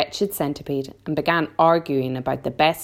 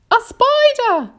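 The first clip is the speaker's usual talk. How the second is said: high, loud, drawn-out shriek of disgust; horrified cry; 7 dB louder, slower than usual. A narrator's reading. Aunt Sponge spots a spider on the ceiling, crying out. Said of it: "she cried".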